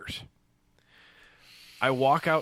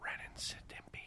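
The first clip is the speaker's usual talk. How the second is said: whisper; 21 dB quieter than usual. Real, not acted.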